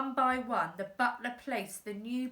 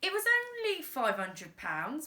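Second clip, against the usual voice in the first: higher pleading dad; dad pleading with the crime boss; 6 semitones higher than usual. Acted. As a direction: beg him, it was only a little. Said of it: "pleaded Dad".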